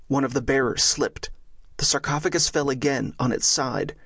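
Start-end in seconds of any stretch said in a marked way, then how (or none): none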